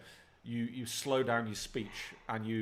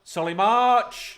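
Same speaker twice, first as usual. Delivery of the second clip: excited shout; 9 semitones higher, 11 dB louder, much slower than usual. Real, not acted. Big chance in live match.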